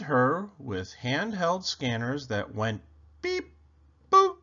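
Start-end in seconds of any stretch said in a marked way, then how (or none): 3.2-4.4 high electronic beep and boop; robotic beep boop. Acted. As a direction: chirp like a little scanner. Scanners went beep boop.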